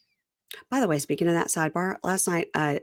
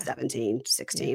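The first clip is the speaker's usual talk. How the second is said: whispered guess; 5 semitones lower, 7 dB quieter than usual. Real, not acted. Guessing the age of consent.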